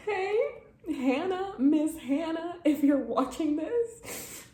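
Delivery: mock angry sob; tearful laugh-cry. Real, not acted. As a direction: call out to the author through tears. Addresses author through tears.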